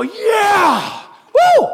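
high, loud whooping cheer; mock cheer. Preacher imagines the crowd cheering, a high excited whoop. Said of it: "Don't you expect everyone to go".

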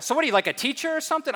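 high, quick-fire mock hairdresser; gum-chewing hairdresser. Hairdresser pressing her client about his job, fast high-pitched chatter. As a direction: rattle off a nosy question in caricature. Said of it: "chewing her gum and cutting my hair".

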